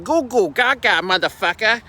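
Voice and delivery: hammed-up newborn with a Boston accent. Vlogger joking that nobody is born talking like this, mock Boston accent. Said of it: "people in Boston that don't have Boston accents".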